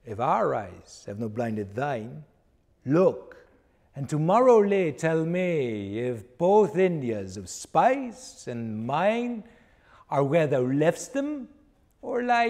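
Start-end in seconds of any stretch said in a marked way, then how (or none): none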